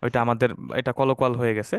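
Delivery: ordinary talk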